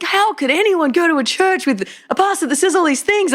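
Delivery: lightly voiced, high scandalised public; a hint of indignant churchgoers; outraged critics. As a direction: mimic shocked outsiders, hammed up. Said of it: "everyone's like".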